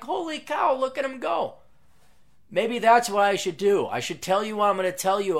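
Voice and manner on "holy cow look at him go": high, mock-astonished exclaim; amazed. Surprised at a rival's subscribers, high mock amazement. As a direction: marvel at his follower count. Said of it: "I'm like".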